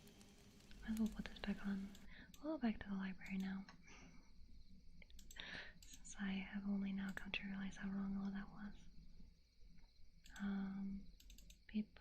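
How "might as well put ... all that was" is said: whisper. Real, not acted. Whispering to stream viewers, puzzled whisper. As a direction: breathe the words, no voice.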